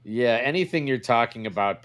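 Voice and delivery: usual speaking voice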